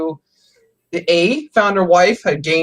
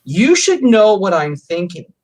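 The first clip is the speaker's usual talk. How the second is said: slow, louder quoted reproach; imitated demand; 3 semitones higher, 5 dB louder, slower than usual. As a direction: imitate a partner's insistent expectation, drawn out. Voices women's demand he heard.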